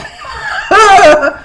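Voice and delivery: loud burst of laughter, high; real laugh. Real, not acted. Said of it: "You know, I laugh a lot".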